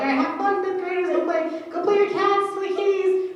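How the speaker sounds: high, singsong teacher voice; mock cheery teacher. Teacher sending fifth graders out to play, high and chirpy.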